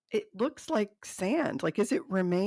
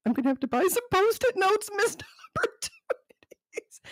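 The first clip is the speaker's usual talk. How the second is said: laughing; 11 semitones higher than usual. Real, not acted. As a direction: giggle it out. Laughs at her own joke.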